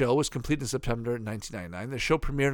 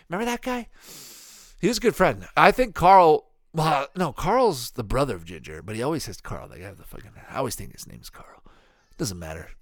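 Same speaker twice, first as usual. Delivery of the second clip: normal talking voice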